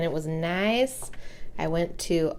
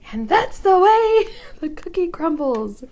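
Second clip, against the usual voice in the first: much higher, louder zany movie-character voice; Ace Ventura quote; 11 semitones higher, 6 dB louder than usual. Retold or imitated. Quoting Ace Ventura while crafting, zany high voice. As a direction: ham it up like a goofy comedy hero. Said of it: "it's Ace Ventura".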